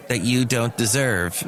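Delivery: usual speaking voice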